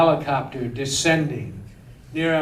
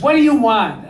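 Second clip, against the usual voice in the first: raised, louder voice as a suspicious wife; curt wife impression; 6 semitones higher, 6 dB louder, faster than usual. Arsonist's wife answers the director's call, curtly. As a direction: imitate a wife sharply answering an unwelcome call.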